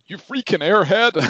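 high, loud mock insult; mock yell. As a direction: shout it like a dubbed-over swear. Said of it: "it'll be like you going".

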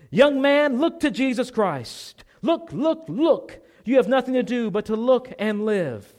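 high, full-throated pulpit shout; Methodist preacher's shout. Acted. Preacher calling on a young man to look to Christ, fervent shout. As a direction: shout it urgently, pitch leaping on each "look". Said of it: "he shouted, as only a primitive Methodist could do".

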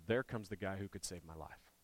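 a softly hoping tax collector; hopeful hush. Retold or imitated. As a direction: say it like a quiet hope of rescue. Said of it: "probably thought one of two things".

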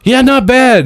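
lightly voiced cop shrugging it off. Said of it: "And he goes".

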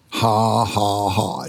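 defiant little ha ha ha; laughing. Retold or imitated. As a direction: laugh it off, mocking the symptoms. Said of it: "just started laughing".

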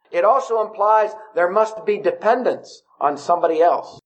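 ordinary talk

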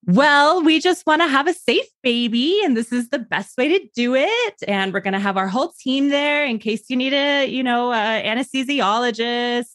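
high, insistent mimicry of a doctor; loud-talking doctor. Mother mimics doctor talking over her, high and insistent. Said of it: "talking really loud".

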